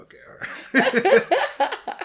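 high squeaky laughing reply. Real, not acted. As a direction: laugh out the words.

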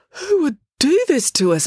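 high, anguished question; distressed. Acted. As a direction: ask in despair. Joe voiced in story.

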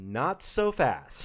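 slow, drawn-out warning to stop; halting someone. Retold or imitated. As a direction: stretch each word to stop them in their tracks. Repeats his halting line proudly.

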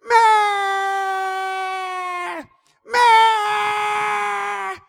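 high, loud goat bleat. Retold or imitated. Goat calling for its mother, loud bleat. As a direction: bleat like a lost kid goat.

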